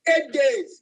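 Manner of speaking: Eight hit high and loud; stress on eight. Real, not acted. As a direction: punch the word Eight, then let days drop. Emphatic repeat of eight days.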